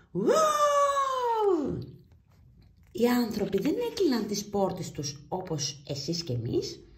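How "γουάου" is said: long, very high howling woof; dog howl. Acted. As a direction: howl it like a huge noisy dog. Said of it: "πάρτε μια βαθιά ανάσα". (ουρλιαχτό σκύλου, πολύ ψηλά)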